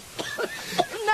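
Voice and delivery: raspy, breathless cough; rough hacking cough; choking cough. Acted. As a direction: cough on bad air. Choking in smoke.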